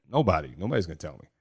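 usual speaking voice

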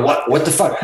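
normal talking voice

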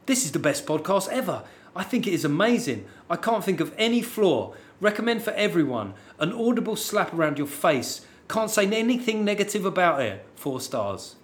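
slightly sarky. Acted. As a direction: lay on fake praise with a sly edge. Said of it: "just read it in a slightly sarky voice".

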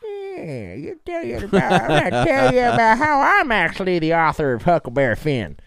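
high, loud Appalachian hillbilly voice; a cheeky Appalachian hillbilly accent; mock hillbilly accent. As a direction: ham it up in a Southern backwoods accent. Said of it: "hillbilly accent".

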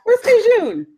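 high, loud mock search call; playful calling out. Playfully calling out for someone absent.

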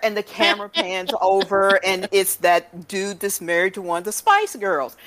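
ordinary delivery

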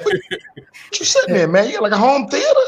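high laughing disbelief. Real, not acted. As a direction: laugh through the question. Laughing at guest's far seat.

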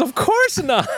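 high, squeaky innocent denial; high-pitched woman's voice. Retold or imitated. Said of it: "She goes".

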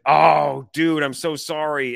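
drawn-out, loud "oh" into apology; commiserate, stretching the words out; retold apology. Fan apologizing to a stranger after a goal, mock-sympathetic apology.

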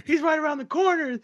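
very high, frantic imitated child; panicked kid voice. Kid urging dad to chase the robber, very high and frantic. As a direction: urge your dad on like a scared kid.

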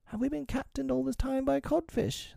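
very high-pitched pirate grumble; muttering pirates. A narrator's reading. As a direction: complain in a high, put-on crewman's voice. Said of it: "they muttered".

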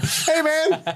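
very high-pitched playful call; mock friendly greeting. Swimmer hailing Andy in the water, high mock greeting. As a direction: pretend to hail a pal in a squeaky-high voice.

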